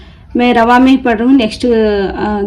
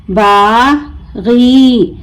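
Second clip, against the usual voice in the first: बागी very slow, long बा, pause, long गी; broken into syllables; much slower than usual. Shown as a demonstration. Teacher breaking the word for learners, बा... गी stretched apart. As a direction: draw out बागी as two separate syllables. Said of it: "तोड़ तोड़ कर पढ़ूंगी".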